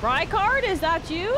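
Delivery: loud, high call to the boss; excited call. Real, not acted. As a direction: call out playfully.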